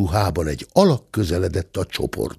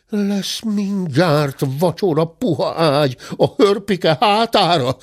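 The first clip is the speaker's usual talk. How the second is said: slow, higher-pitched grumble; grumbling; 7 semitones higher than usual. A narrator's reading. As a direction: grumble it out slowly. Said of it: "dörmögte a bátya".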